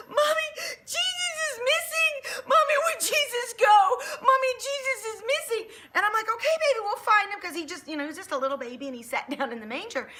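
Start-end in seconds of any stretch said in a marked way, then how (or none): 0.1-5.8 put-on high, loud, tearful child's wail; crying little girl. Little daughter crying to mommy, high tearful wail. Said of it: "She went crying". 6.3-7.4 soothing mom. Retold or imitated. Mom soothing crying daughter.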